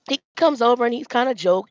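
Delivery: normal talking voice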